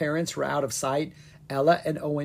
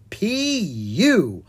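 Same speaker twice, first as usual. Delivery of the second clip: mock disgust; 7 semitones higher, 5 dB louder than usual. Stinky fish shop smell.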